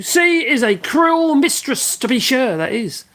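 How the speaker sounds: high, slow hammed-up old sailor; lightly voiced mock sailor; mock old sea dog. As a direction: ham it up like a salty old seaman. Sailor saying about the sea.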